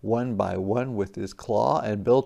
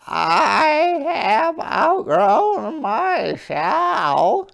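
very high, slow little crab; a tiny crab speaking sweetly; small hermit crab voice; 13 semitones higher, 8 dB louder, much slower than usual. Acted. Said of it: "she said".

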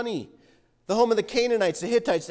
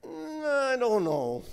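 drawn-out, softer hesitation; reluctant doubt; 4 dB quieter, slower than usual. Acted. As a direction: stall slowly, unsure about saying yes. Moses voiced doubting God's command.

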